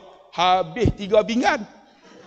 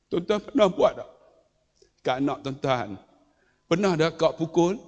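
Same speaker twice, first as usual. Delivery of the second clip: normal talking voice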